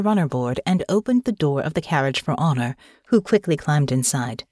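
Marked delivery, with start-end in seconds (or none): none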